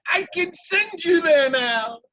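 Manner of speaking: high, slow, joyous otter cheer; high delighted squeal, drawn out slowly; excited otter. Acted. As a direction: burst out with joy at the good news. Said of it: "and he says".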